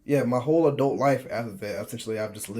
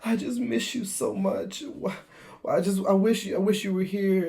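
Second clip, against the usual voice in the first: mock crying; 9 semitones higher than usual. Recruits crying to their moms on the phone, mock sobbing plea. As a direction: whimper it like a homesick kid, half-joking.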